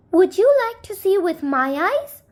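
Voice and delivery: lightly voiced small neighbor; little man offering. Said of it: "asked the little man".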